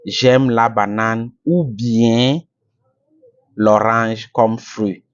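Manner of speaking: slow, careful, each word laid out; slow example sentence. Shown as a demonstration. Teacher to YouTube learners, slow careful example. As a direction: spell it out slowly for learners. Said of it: "I can say".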